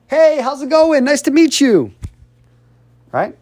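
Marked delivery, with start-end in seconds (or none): 0.1-1.9 high, upbeat confident greeting. Acted. Confident person meeting someone new, upbeat and confident. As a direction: greet them chest up, with a big smile. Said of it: "How does a confident person look like?".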